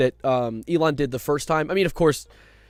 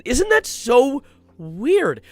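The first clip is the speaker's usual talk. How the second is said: sarcastic; 7 semitones higher, much slower than usual. Real, not acted. Sarcastic comment on salute.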